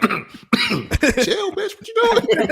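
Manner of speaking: half laughing, telling her off; laughing mock scold. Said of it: "He was like".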